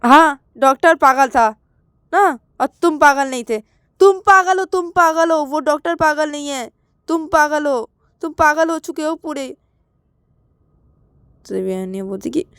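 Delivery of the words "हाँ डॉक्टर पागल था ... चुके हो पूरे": high, scolding comeback; worked-up, high-pitched telling-off; heated retort. Acted. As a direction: throw the accusation back, voice raised. Acted quarrel, sarcastic retort.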